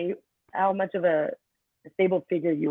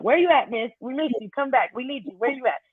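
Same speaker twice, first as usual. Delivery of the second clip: mock kids, pitched higher; kids pleading; 6 semitones higher than usual. Teacher voicing her students begging her to return, higher.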